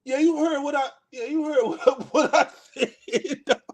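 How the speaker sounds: laughing through the quote. Retold or imitated. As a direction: say the tough line but crack up. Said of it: "I'm like".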